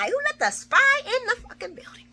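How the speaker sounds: Lucy fuming that the spy got in; outraged yell. Retold or imitated. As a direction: call her out in front of everyone. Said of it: "and Lucy's like".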